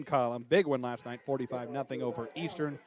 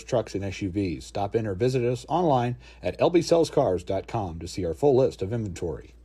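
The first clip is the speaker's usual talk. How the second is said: lower, louder polished commercial read; sponsor ad read; 5 semitones lower, 5 dB louder than usual. Acted. Announcer reading sponsor messages to radio listeners, ad read. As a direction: sell it like a radio spot.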